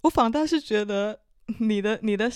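ordinary talk, nothing marked

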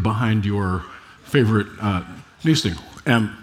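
talking around a piece of candy; mouth full. Real, not acted. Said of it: "I have candy in my mouth".

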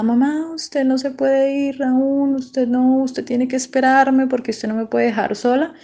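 retold desperate cry, high but not shouted; desperate pleading. Daughter calling after her mother as she leaves, desperate pleading. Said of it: "yo grité eso desesperada".

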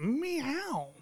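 soft flirty meow; mock cat meow. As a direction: purr out a cheeky meow. Playful cat sound after joke.

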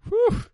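high, loud whoop of relief; joyful high-pitched whew. Retold or imitated. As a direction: let out a sharp relieved whew. Relief at the retrieve.

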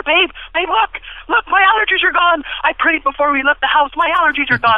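high, loud, breathless joy; excited. Retold or imitated. Husband excitedly telling his wife allergies are gone. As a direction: tell her the good news bursting with glee. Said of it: "I was so excited".

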